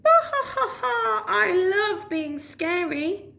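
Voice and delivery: high, slow gloating cackle; villain laugh. Acted. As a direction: cackle with glee at being scary. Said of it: "laughed Alan".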